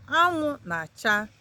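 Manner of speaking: Anwụ na-acha said slowly, each syllable clear; slow it down so learners catch Anwụ na-acha; slow teaching repeat. Shown as a demonstration. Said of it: "That means the sun is shining".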